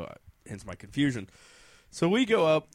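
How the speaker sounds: ordinary talk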